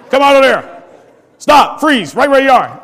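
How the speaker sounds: loud, high order to halt; shouted command. Acted. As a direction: shout like you're stopping an intruder. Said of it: "somebody would come and say".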